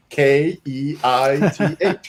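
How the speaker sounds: slow letter-by-letter spelling; retelling a sketch clerk spelling the name slowly; slowly spelled out. Retold or imitated. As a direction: spell each letter deliberately. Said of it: "He goes".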